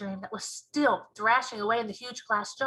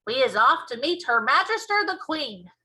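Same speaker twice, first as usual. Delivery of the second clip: loud, rousing announcement; excited giant's proclamation; crying out; 3 semitones higher, 7 dB louder than usual. A narrator's reading. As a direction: cry it out as you set off. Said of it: "cried the B F G".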